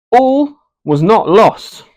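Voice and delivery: high, loud and slow; dramatic. Acted. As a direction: proclaim it like good news. Turning point after the criticism.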